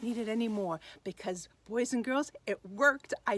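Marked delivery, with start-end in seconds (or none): none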